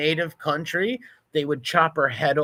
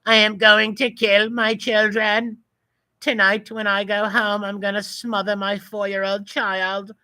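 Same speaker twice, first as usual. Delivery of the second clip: mocking put-on voice; 6 semitones higher than usual. Voicing a protester on the screen to mock him, higher sneer. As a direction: put words in his mouth, mocking.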